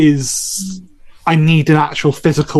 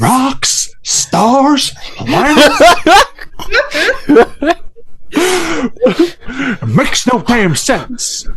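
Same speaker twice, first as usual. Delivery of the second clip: high, slow Southern Benoit Blanc drawl, laughing; Benoit Blanc accent; 10 semitones higher, much slower than usual. Pretend. A Benoit Blanc Southern accent, done as a joke for the cohost. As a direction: ham up a Southern detective accent, cracking up. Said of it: "They really love Benoit Blanc".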